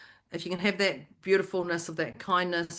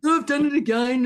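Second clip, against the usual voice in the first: higher, louder self-scolding groan; mock self-scolding; 6 semitones higher, 7 dB louder than usual.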